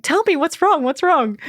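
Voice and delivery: high, pleading panic; panicked plea. Retold or imitated. Patient begging the silent dentist, panicked. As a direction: beg the doctor to tell her. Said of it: "in a state of panic".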